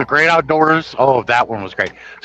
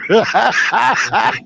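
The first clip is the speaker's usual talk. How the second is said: laughing; 10 semitones higher than usual. Real, not acted. Laughing at own retelling.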